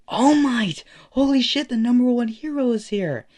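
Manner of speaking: very high, loud fan excitement; starstruck crowd. Acted. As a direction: squeal it out like thrilled students. Class thrilled at hero's arrival.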